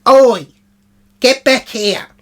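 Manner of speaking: high, loud summons; angry call. Retold or imitated. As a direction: yell her back to the table. Summoning Jill back to table.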